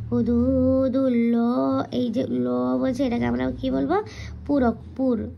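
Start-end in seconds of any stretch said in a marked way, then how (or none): 0.1-1.8 হুদুদুল্লাহি with a rounded, heavy লা; হুদুদুল্লাহি, heavy rounded lam, slow recitation; full heavy Allah. Shown as a demonstration. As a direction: draw out হুদুদুল্লাহি slowly, lips rounded on লা. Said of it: "তাই এটা পুর করে পড়তে হবে".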